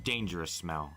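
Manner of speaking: plain reading voice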